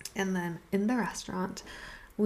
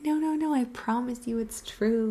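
insistent protest; 4 semitones higher, 4 dB louder than usual. Retold or imitated. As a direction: protest urgently, pitch jumping up. Insists her story is true.

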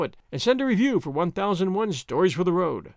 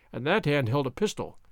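plain reading voice

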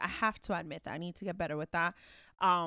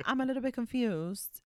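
higher, carefully puzzled demo; put-on mild bewilderment; polite feigned confusion; 4 semitones higher than usual. Shown as a demonstration. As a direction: lift the pitch, acting innocently confused. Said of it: "my line is".

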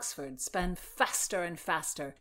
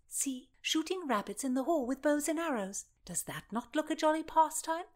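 high, lilting invitation; coaxing enticement; 7 semitones higher than usual. A narrator's reading. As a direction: tempt him brightly toward the game. Constance coaxes him toward a game.

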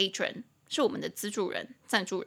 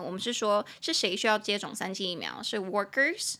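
normal talking voice

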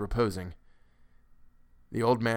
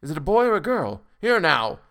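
higher, louder irritated outburst; 6 semitones higher, 6 dB louder than usual. A narrator's reading. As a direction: cut in with sharp impatience. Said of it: "in a perfect passion of irritation".

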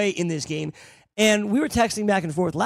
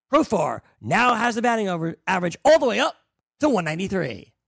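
mock-impressed, pitched up on "all the way up"; sarcastic; 3 semitones higher than usual. Real, not acted. Co-host needling Greg's partner about Profar's average, sarcastic. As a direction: play up a feeble stat like a triumph. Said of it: "Why are you being so sarcastic".

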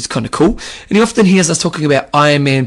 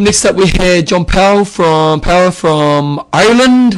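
drawn-out, higher mock Irish accent; slow, louder put-on Irish lilt; Irish impersonation; 4 semitones higher, 4 dB louder, slower than usual. Co-host introducing a listener from Ireland, in a mock Irish accent. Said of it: "that was my Ireland, Irish impersonation".

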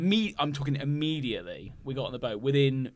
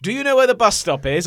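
asking directions; 6 semitones higher, 8 dB louder than usual. Retold or imitated. Tourist asking directions, acted quote.

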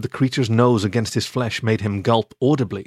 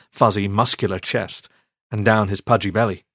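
ordinary delivery

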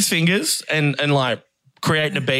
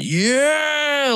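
long, high mock cheer; pub crowd cheering; 12 semitones higher than usual. Storyteller imitating the whole pub's reaction, drawn-out high cheer. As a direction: draw out a high whoop of a yeah. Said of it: "everyone in that pub just went".

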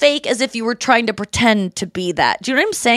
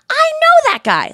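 much higher, louder gleeful exclaim; excited recognition; 4 dB louder than usual. Retold or imitated. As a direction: squeal at spotting him. Recognizing him on The Bachelor.

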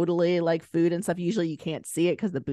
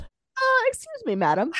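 much higher, prim little voice; mock child voice. Mother imitates her nine-year-old correcting her, high and prim. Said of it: "my nine-year-old".

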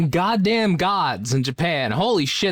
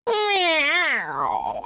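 cat meow; 10 semitones higher than usual. Acted. Answering the cat voice.

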